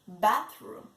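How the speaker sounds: puppet echoing in a changed voice. Acted. Puppet Monkey repeating the word for the class.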